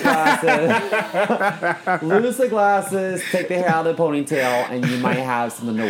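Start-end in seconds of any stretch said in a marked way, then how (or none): 0.0-2.0 laughing. Acted. Mock makeover advice, laughing. 2.0-4.7 laughing. Acted. Mock makeover advice, laughing.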